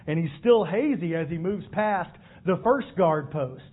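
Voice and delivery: ordinary talk, nothing marked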